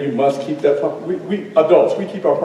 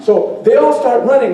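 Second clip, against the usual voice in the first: ordinary delivery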